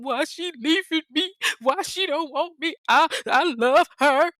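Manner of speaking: mocking him crying on the couch; mock boo-hoo crying. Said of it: "boo-hooing because he loves her".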